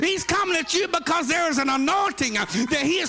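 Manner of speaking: loud, high preacher's climb; rising shouted sermon; preaching at full force. Acted. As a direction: preach it hard to the church.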